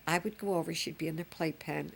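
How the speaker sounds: usual speaking voice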